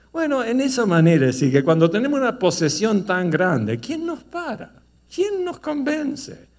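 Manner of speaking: high, loud preaching cry; fiery preaching. Acted. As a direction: thunder it at the congregation. Preaching builds to full force. (predicación fogosa, aguda y fuerte)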